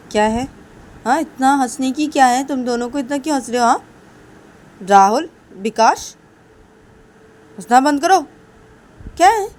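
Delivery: exasperated demand to stop; acted scolding. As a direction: scold them sharply, rising at the end. Siya scolds the two laughing.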